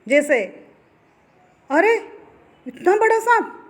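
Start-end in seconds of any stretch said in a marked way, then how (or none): 1.7-3.6 high-pitched exclamation of amazement; acted surprise, much higher voice; demonstrated astonishment. Shown as a demonstration. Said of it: "विस्मय है".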